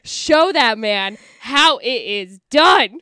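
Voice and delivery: put-on slow, loud, emphatic girl-talk; hammed-up urging. Daughter and sister urging Erica, hammed-up slow insistence. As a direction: egg her on, punching each word.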